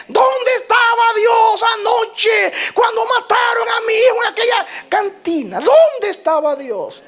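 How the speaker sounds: high, loud demand, slow on dónde; furious shout. Acted. Enraged father shouting at the pastor, demanding answers. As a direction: confront the pastor head-on. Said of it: "lo golpeó con todo lo que tenía".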